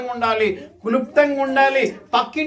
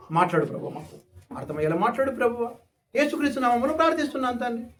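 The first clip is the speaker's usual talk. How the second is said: quick, plain short prayer; a short, meaningful prayer, said fast; brief prayer demo; 4 dB quieter, faster than usual. Shown as a demonstration. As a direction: rattle off a simple prayer. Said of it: "అర్థవంతంగా ఉండాలి".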